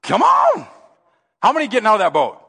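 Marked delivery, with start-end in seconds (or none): 0.0-0.7 very high, raised beckoning shout; ringing high call; Jesus calling. Acted. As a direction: beckon loudly from across the water. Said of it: "Jesus says to you".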